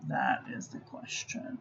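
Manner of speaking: soft whispered aside; whispering. Real, not acted. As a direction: whisper it to yourself. Murmuring to herself while editing.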